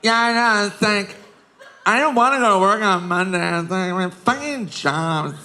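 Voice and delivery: put-on hammy tipsy girl complaining at the bar; drunk girl at a bar. Drunk girl whining to a friend at the bar, mock.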